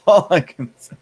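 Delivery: laughing through the words. Real, not acted. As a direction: let the laugh break in. Laughing at own shaming.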